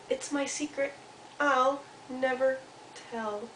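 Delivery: soft, slightly high, slow mouse voice; guard the secret gently, word by word; secretive little Mouse. Acted. Mouse answers Squirrel, soft and secretive.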